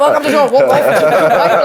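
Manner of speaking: loud, laughing retold line; laughing through it. Retelling the owner's words to the listener, laughing loudly. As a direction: crack up while quoting her. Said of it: "She was like".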